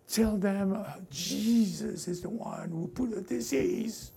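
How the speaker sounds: hushed, whispered tempter; quietly planting a lie; sly hushed enemy voice. Retold or imitated. Preacher voicing the enemy whispering lies to believers, hushed and sly.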